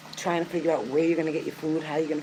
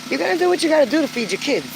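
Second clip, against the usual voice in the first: higher and faster, pushing the point hard; urgent and pressing, quicker; impassioned; 9 semitones higher, 4 dB louder, faster than usual. Real, not acted. Impassioned plea about feeding kids.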